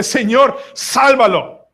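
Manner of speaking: high, forceful plea from the boat; crying out for another. Acted. Preacher acting those in the boat, crying out. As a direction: call out to God to rescue him. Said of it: "qué debe estar gritando los que están en el bote".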